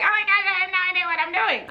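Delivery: high, quick panicky squeal; panicked self quote. Retold or imitated. Vlogger reliving her overwhelmed panic, squealing high and fast. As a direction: act out your past self spiralling in panic.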